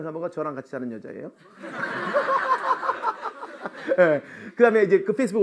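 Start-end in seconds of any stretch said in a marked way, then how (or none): 1.6-3.9 high burst of laughter; high falling laugh; big laugh. Real, not acted. Joke about living with his wife.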